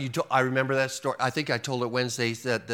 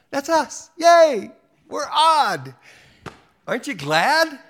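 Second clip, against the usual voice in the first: much higher, loud playful cheering; mock cheer; 12 semitones higher, 6 dB louder, slower than usual. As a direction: cheer like a delighted kid about being odd.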